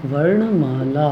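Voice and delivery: वर्णमाला drawn out at about half speed; slow dictation. Shown as a demonstration. Teacher giving the Hindi word for alphabet, slow dictation. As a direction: stretch वर्णमाला out slowly, syllable by syllable.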